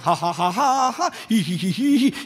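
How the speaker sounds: mock haha and hihihi laugh; imitated girlish laughter. Pastor imitates his type of girl, mock laughing. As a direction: fake a big laugh into a giggle.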